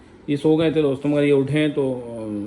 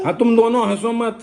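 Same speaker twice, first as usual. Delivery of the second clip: imitating daughter; 5 semitones higher, 5 dB louder than usual. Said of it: "तो गीत क्या कहती है फिर?".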